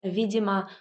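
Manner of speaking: ordinary delivery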